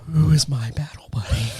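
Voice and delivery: hammed-up whispered panic; panicked whisper. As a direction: whisper it to yourself in mock alarm. Recruit panics to himself.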